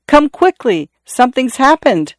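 high, urgent call for help; sudden high alarm call; cried out. A narrator's reading. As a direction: cry out for the others to come. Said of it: "Then suddenly he cried".

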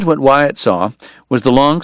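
ordinary delivery, nothing marked

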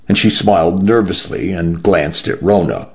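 plain reading voice

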